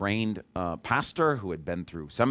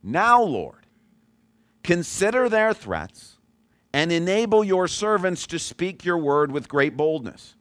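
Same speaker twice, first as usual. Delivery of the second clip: raised, slow, solemn Bible reading; scripture reading; 6 semitones higher than usual. Acted. Pastor reading Acts to the congregation, solemn and slow. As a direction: lift each phrase of the passage slowly. Reads scripture passage aloud.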